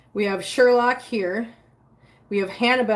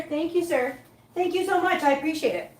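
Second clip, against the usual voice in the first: mock polite thanks; 6 semitones higher than usual. Real, not acted.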